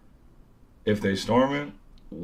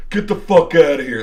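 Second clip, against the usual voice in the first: loud, higher mock order; angry guard; 7 semitones higher, 9 dB louder than usual. Security guard grabs him and points away, angry order. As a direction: imitate a guard throwing someone out.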